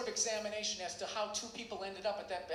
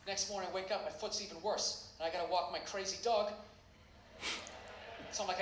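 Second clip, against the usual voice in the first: normal talking voice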